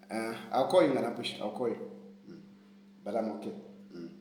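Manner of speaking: quiet phone aside. Real, not acted. Answering his lawyer on the phone mid-stream, low and quiet. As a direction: drop right down as if taking a call.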